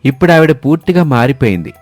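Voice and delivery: plain reading voice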